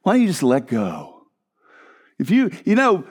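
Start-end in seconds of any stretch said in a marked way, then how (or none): 0.0-1.2 a hint of a condescending friend waving it away; dismissive friend. Preacher imitating a friend who shrugs off his sorrow, mildly lower. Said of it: "somebody comes along and goes".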